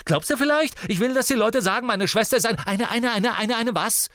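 high, loud, heated outburst; furious. A narrator's reading. Brother fears gossip about his sister, furious. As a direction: rage on until the words stumble. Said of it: "erwiderte Ron genauso zornig".

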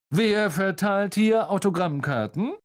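high, grandly projected question; self-important, showy inquiry; jovial pompous celebrity. Acted. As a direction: ask it like a star taking over.

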